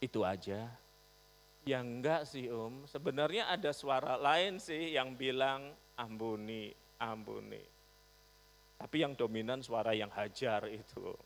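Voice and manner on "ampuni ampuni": softer, gentle urging to forgive; quiet inner voice. Retold or imitated. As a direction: murmur mercy. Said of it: "suara pelan yang berbicara di dalam hati". (suara hati yang pelan)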